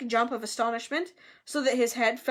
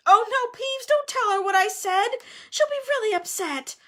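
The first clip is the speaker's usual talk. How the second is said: high, urgent pleading, voiced rather than whispered; frantic; 11 semitones higher, 5 dB louder than usual. A narrator's reading. Hermione begging Peeves not to tell Myrtle, frantic. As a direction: plead in a panic, pitched right up. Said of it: "Hermione whispered frantically".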